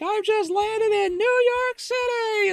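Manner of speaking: very high, slow, drawn-out squeal; excited girl. Retold or imitated. Imitating an excited girl in a short video, shrieking with excitement. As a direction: shout it out in giddy, high-pitched excitement. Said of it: "this girl's all excited".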